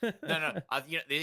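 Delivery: ordinary delivery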